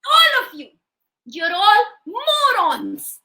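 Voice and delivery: high start, slow drawn-out insult; hammed-up scolding; scolding wife. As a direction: berate the crowd with relish. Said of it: "Can you all do this with me".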